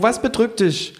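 hohe Figurenstimme, nachfragend; Känguru. Acted. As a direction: mit hoher Stimme nachfühlen. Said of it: "sagt das Känguru". (hohe Känguru-Frage)

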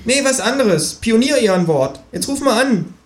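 high kangaroo voice, pleased with itself; the kangaroo gloats about its scheme; cheeky kangaroo. A narrator's reading. Kangaroo urges.

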